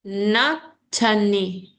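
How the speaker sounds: Natani said very slowly with a schwa; slow schwa syllable. Shown as a demonstration. Teacher models schwa in monotony for student, slowly. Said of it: "This is chwa".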